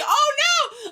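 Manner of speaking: high, loud mock shout; alarmed outcry. Retelling spotting Gildan in the video, alarmed high shout. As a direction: cry out in exaggerated dismay.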